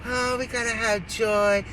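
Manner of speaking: high, slow, put-on dreamy voice; mock her airy, drawn-out high voice; mood-swing mimicry. Voter mocking a candidate's mood swings, syrupy joyful act. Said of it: "her mood swings go from".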